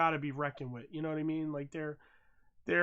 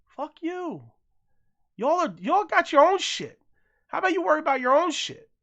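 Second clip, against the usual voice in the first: high, loud, fed-up rant; angry rant; 10 semitones higher, 4 dB louder than usual. Real, not acted. Ranting at foreign critics online, angry and loud. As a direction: tell them off, really heated. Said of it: "ranting at foreign critics".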